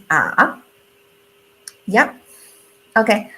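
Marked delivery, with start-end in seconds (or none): 0.1-0.6 low "Ah" dipping down then up; third tone dip. Shown as a demonstration. Teacher demonstrating the dipping third tone. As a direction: dip "Ah" low and bring it back up. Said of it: "so this is".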